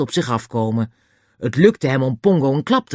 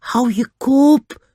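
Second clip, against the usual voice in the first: high, loud, drawn-out snap; vehement; 13 semitones higher, 6 dB louder, much slower than usual. A narrator's reading. George silences the pleading child, vehemently. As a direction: cut them off fiercely, high and loud. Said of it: "zei George heftig".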